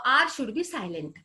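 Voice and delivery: normal talking voice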